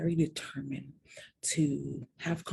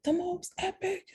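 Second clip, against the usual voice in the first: very high, breathless excitement; telling sister about first kiss, giddy and very high; giddy gushing. Retold or imitated. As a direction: gush like a smitten teen.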